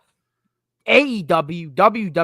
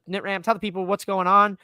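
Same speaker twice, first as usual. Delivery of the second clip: ordinary delivery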